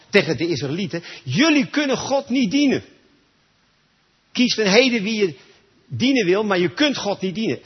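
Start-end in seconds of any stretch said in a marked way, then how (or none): 1.2-7.6 louder, higher stern warning to the people; a hint of Joshua thundering at the crowd. Joshua warning the Israelites, raised and stern. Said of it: "daar zegt Jozua tegen God".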